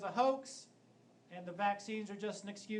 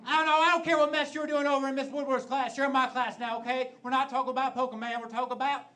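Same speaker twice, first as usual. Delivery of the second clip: put-on Southern teacher accent; 6 semitones higher, 10 dB louder than usual. Stand-up comic imitating a Southern math teacher scolding his class, loud Southern accent. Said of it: "he always had the heaviest Southern accent".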